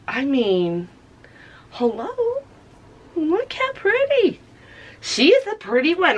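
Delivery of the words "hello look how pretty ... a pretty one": squeaky high admiring coo. Real, not acted. Vlogger admiring her paint, high coo. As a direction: coo up high at a pretty thing. Cooing at the color.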